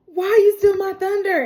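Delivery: high, loud mock protest; indignant complaint. [name] accuses Malcolm of upstaging him, loud mock indignation. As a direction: play up the wounded outrage.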